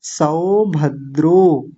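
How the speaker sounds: सौभद्रो very slowly, सौ open and द्रो closed; slow teacher demo; slow syllables. Shown as a demonstration. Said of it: "और ध्यान से देख लीजिए".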